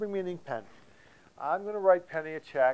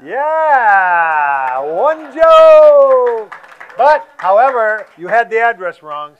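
loud, high mock verdict; mock shout; 6 semitones higher, 16 dB louder, much slower than usual. Real, not acted. Host shouts his ruling at the joking comic. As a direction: bellow the ruling like a game host.

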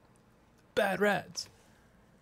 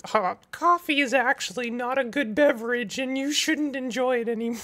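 puppet voice; 7 semitones higher than usual. Retold or imitated. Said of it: "It's stuff like".

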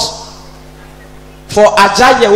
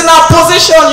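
robber's order; 10 semitones higher, 6 dB louder than usual. Retold or imitated. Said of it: "They say".

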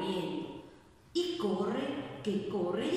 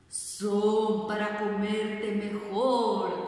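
louder, slow, menacing wolf voice; 5 dB louder, much slower than usual. Acted. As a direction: drag it out before the pounce. Wolf reveals himself before eating.

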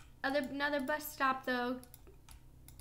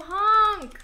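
silly clown; 4 semitones higher, 11 dB louder than usual. Pretend. Imitating the bus horn.

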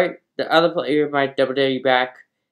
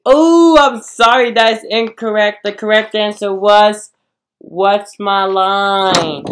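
game show host; 5 semitones higher, 8 dB louder than usual. Acted. Game host announces wrong answer.

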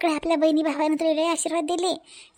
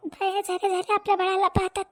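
put-on a mother overcome on finally seeing her baby; crying through the words; tearful; 4 semitones higher than usual. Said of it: "अरे, रडू नको छाया".

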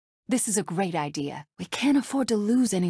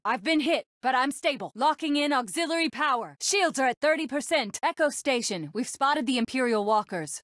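high, urgent report from the cockpit; urgent battle callout; 6 semitones higher than usual. Acted. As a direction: call out battle status under fire. Battle callouts under fire.

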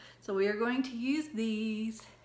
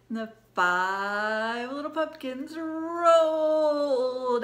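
slow drawn out; 3 semitones higher, 9 dB louder, much slower than usual. Acted. Drawn-out louder ending.

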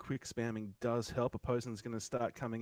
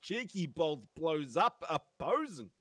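slow astonished exclamation; stunned; 6 semitones higher, 8 dB louder, slower than usual. Real, not acted. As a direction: react to the huge crit in disbelief. Stunned by the big crit.

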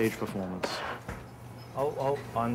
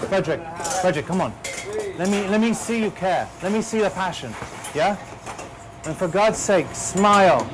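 much higher and louder, driving him on; loud exhortation; 8 semitones higher, 4 dB louder than usual. Real, not acted. Head chef to Frederick at the stove, loud urging. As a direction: shout him into caring.

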